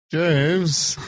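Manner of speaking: high, mock English butler call; English accent. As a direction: sing out the name in a put-on English accent. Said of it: "say it in an English accent".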